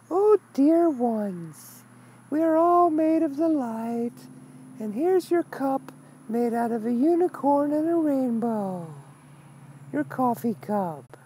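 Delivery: very high, slow, sugary mock guru; saccharine love-and-light teacher. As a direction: ham up a gushing teacher cooing to students. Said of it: "I prefer my teachers to go".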